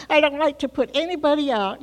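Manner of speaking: ordinary delivery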